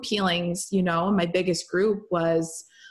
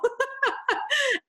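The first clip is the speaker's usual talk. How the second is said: a high, open laugh; high burst of laughter. Real, not acted. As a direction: crack up at the joke. Laughter after mentor's joke.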